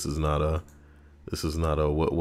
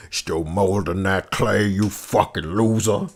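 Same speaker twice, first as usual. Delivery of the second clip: slow, sneering dad voice, lightly put on; mock abusive dad. Dad mocking his son over the clay, slow sneer. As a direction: jeer at the kid like a cruel father. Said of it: "Their dad used to kick him and say".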